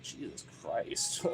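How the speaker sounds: slow breathy whisper of disbelief. Retold or imitated. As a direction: mutter it under your breath, stunned. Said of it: "I'm like".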